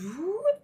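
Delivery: rising high whoop of the sun jumping a portal; a quick playful whoop. Retold or imitated. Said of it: "then he goes".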